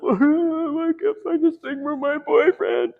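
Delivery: high, slow, tearful falsetto; high weepy mock voice of a woman; mock crying woman. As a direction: whine it like a sobbing girlfriend. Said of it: "and she's like".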